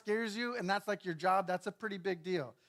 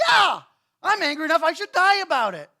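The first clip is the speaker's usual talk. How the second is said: high, loud sulky outburst; mock fury; 9 semitones higher, 13 dB louder than usual. Jonah answering God in a huff, loud mock fury. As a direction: blurt it out in a petulant rage.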